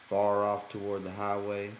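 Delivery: ordinary delivery, nothing marked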